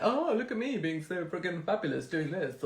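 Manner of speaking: a touch of preening show-off voice; mocking show-off. Mocking vain social media posters, lightly preening. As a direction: mimic a smug poser lightly. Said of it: "someone's like".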